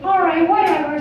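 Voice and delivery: high, grudging cartoon voice; high-pitched cartoon mouse, relenting; Mickey Mouse. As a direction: give in with a mouse squeak. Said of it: "And he's like".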